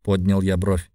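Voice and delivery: ordinary delivery, nothing marked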